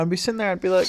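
normal talking voice

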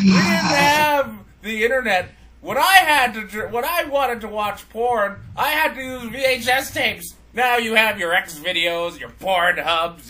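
hammed-up back-in-my-day rant; ranting parent. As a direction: berate your kid over how hard you had it. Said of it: "I'm just all like".

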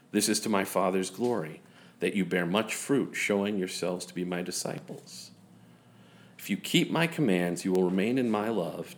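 soft, measured Bible reading; scripture reading. Acted. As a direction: read the passage gently and evenly. Reads John fifteen aloud.

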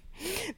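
short high giggle. Real, not acted. Laughing at the sisters' prank, a high giggle. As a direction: let a quick giggle escape. Laugh after the retold secret.

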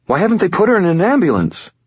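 high, quick protesting question. A narrator's reading. Molly asks about the ambulance, protesting. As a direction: push the question out fast and high. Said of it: "Molly protested".

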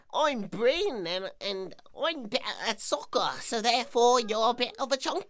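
put-on smug, high, loud English accent taunt; Bryn's English accent. Soccer show-off gloating in a high, loud English accent. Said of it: "in his little stupid accent, would go".

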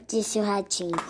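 ordinary delivery, nothing marked

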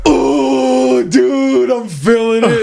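high, stretched-out tripping voice; high giddy voice of a friend feeling the mushrooms; friend starting to trip. Retold or imitated. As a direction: friend in the car says the mushrooms are hitting him, high and drawn out.

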